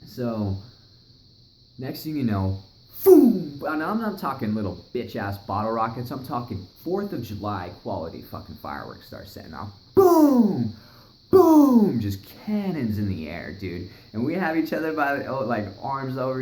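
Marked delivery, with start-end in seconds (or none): 3.0-3.6 loud imitated explosion; big fireworks boom sound; shouted boom. 9.9-12.0 high, loud firework booms; loud repeated explosion sounds; shouted boom. Retold or imitated. Imitating fireworks going off.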